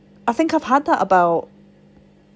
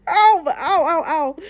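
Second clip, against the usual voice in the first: pain; 6 semitones higher, 4 dB louder than usual. Real, not acted. Just hurt wrist in fall.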